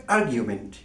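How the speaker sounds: আর্গুমেন্ট pronounced "AR-gyu-ment", with a y glide; standard pronunciation. Shown as a demonstration. Teacher correcting a common form, standard "AR-gyu-ment" pronunciation of আর্গুমেন্ট. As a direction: put "gyu" in the middle of আর্গুমেন্ট. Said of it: "standard pronunciation হবে".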